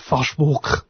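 Facebook in a mock German accent, "Fashvok". Shown as a demonstration. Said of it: "pronounced in a German accent".

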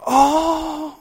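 high, gushing oh; mock delighted oh. Foreigners reacting to a New Zealander, a high gushing oh. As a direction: coo it like you're impressed. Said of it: "and they go".

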